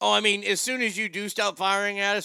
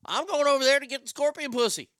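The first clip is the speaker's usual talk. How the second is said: higher, hyped-up sales pitch; eager scorpion; 7 semitones higher than usual. Acted. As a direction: sell it eagerly, pitch jumping up. Scorpion selling the trip.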